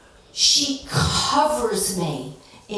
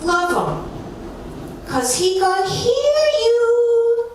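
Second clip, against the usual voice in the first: high, sing-song mock preaching with a Chinese accent; a slow, higher sung warning; imitating the acupuncturist; 11 semitones higher, slower than usual.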